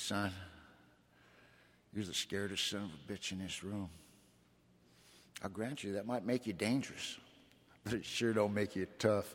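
put-on low, soft old-timer voice putting a kid in his place; lightly voiced old gangster leaning in close; deep gravelly old gangster. Old AA gangster sizing up the scared newcomer, low and soft. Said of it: "Charlie had the deepest, graveliest voice".

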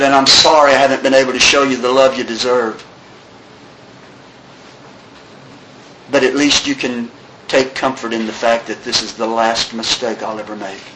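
low, hushed reading of a final apology; somber suicide note. Retold or imitated. Reads friend's suicide note.

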